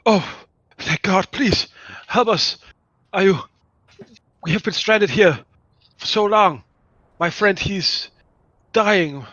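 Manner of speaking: high, halting plea for rescue; frightened, excited plea. Acted. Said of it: "someone who is afraid and just very".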